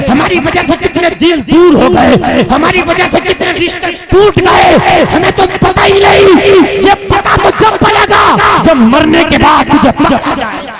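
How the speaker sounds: building to a high, loud shout; preaching climax. Acted. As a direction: hammer each line harder until you're shouting. Sermon rising to climax. (ऊँची आवाज़ में चीखता was)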